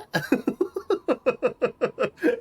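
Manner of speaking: high nervous giggle; nervous laugh. Real, not acted. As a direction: giggle away the nerves. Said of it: "That little nervous laugh".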